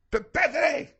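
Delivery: high-pitched dad calling his daughter; imitated calling out. Dad calling daughter from the room, mock holler. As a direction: holler the name, voice shooting up.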